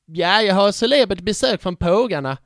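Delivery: bad Scanian imitation of pågarna; mock Scanian accent. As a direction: fake a Scanian accent for a joke. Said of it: "dåliga imitation av skånska". (dålig imitation av skånska)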